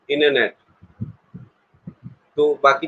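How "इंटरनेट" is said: "इंटरनेट" said as innernet, t silent; American pronunciation. Shown as a demonstration. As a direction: drop the t in इंटरनेट, American-style. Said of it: "ऐसे बोलते हैं वो". (अमेरिकी ढंग से इंटरनेट, ट के बिना)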